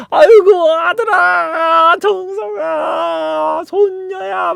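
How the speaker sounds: high, drawn-out mock lament; hammed-up wailing. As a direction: wail each name on one long high note. Family wails for son and granddaughter.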